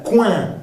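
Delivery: "coin" as kwan with nasal vowel; nasal ending. Shown as a demonstration. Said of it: "al final el sonido A nasal".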